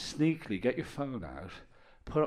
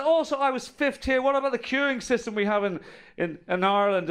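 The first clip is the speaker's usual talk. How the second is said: high, loud indignant protest; mock indignant complaint; 11 semitones higher, 6 dB louder than usual. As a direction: ham up the queue grievance. Complaining about queue to guide.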